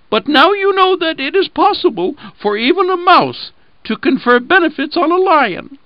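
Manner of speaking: very high little mouse voice, proud; a tiny creature vindicated; exclaiming mouse. Acted. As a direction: mouse reminding the freed lion of its help. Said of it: "and he exclaimed".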